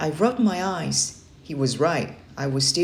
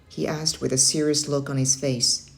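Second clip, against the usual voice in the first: plain reading voice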